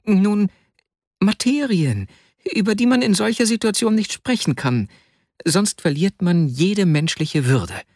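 very high-pitched, insistent protest; indignant Vasja. A narrator's reading. Pinned friend defending his dignity, high-pitched protest.

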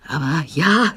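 flustered, hesitant agreement; mildly bewildered assent; confused. A narrator's reading. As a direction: agree as if thrown off balance. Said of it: "sagte der Doktor verwirrt".

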